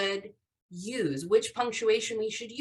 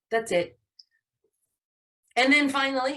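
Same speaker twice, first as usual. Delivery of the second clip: ordinary talk, nothing marked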